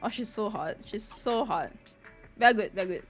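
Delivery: usual speaking voice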